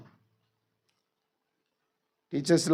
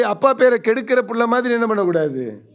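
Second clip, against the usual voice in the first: usual speaking voice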